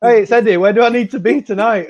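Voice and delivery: light Scottish accent on a retold phone greeting. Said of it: "his Scottish accent".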